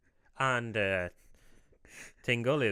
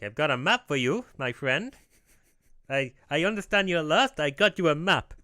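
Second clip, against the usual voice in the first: high, loud cartoon salesman with bad accent; Tingle; 4 semitones higher, 7 dB louder than usual. Map seller offering help to a lost man, high put-on accent. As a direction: pitch it up in a mock foreign accent. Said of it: "I don't know if this is how Tingle sounds".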